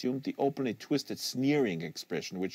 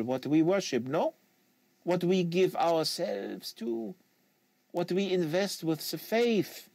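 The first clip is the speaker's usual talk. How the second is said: put-on French Canadian accent; 5 semitones higher than usual. Quebecois agent lecturing the American on fanatic, French Canadian accent.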